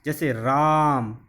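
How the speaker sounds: "राम" with the aa held extra long, as if calling; prolonged calling vowel. Shown as a demonstration. Teacher demonstrating the pluta vowel by calling "राम" slowly. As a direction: stretch the vowel of "राम" like calling someone far off. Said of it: "किसी को पुकारने में ... प्रयोग किया".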